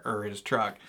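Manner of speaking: normal talking voice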